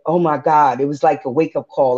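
normal talking voice

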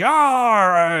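long, loud, high-pitched pirate "arr"; pirate yell. Retold or imitated. Gamer re-enacts answering his mateys out loud, drawn-out pirate yell. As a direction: roar back at the crew like a playful pirate. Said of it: "out loud and in the room, I'd be like".

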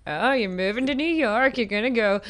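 hammed-up Texas accent, big and brassy. Texan guy mocking hometown cheer in a hammed-up Texas accent. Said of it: "What accent are you doing there?".

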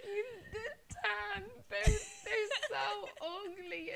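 put-on high, very slow wailing child sob; hammed-up bawling over ugly boots; mock sobbing. Nine-year-old crying to mum about tan boots, mock wailing. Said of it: "And I was like".

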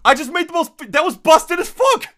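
high, loud, gleeful outburst; excited yelling. Real, not acted. Host boasting to co-host about his busted segue, high excited yell.

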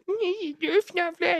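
put-on very high, drawn-out child voice; mock little girl. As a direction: squeak it out slowly like a stubborn kid. Father voicing his daughter.

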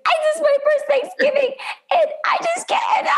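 very high, fast mock wail; imitating Monica losing it. Monica breaking down over her first Thanksgiving, high mock wail. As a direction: squeal like she's about to cry. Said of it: "yelling, started crying".

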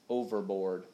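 ordinary delivery, nothing marked

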